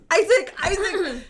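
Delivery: high, slow, laughing call; nervous laughing call. Real, not acted. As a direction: call out half scared, half giggling. Frightened, calling through laughter.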